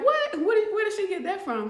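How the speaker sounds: high-pitched, startled inmate; imitated incredulous outburst; shocked disbelief. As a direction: act out baffled surprise, pitch leaping up. Inmate shocked by planted ring box.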